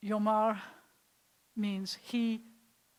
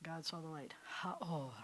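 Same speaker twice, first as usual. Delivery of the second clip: unvoiced, much softer whisper; 4 semitones lower, 11 dB quieter than usual. Shown as a demonstration. Teacher closes the lesson whispering the verse. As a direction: breathe it out in a hush. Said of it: "All we have is breath".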